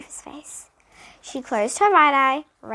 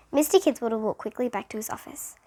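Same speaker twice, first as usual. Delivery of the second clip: ordinary delivery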